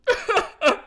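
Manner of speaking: a shrill burst of laughter; high squealing laugh. Real, not acted. Host laughing at own mock sadness, high squealing laugh.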